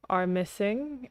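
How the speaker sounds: ordinary talk, nothing marked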